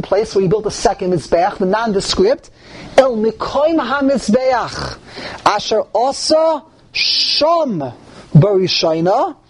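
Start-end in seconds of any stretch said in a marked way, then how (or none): 3.0-7.9 slow, higher recitation, drawing out "Sham"; emphasis on Sham. Shown as a demonstration. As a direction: recite deliberately, leaning long on "Sham". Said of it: "there's an emphasis on the word Sham".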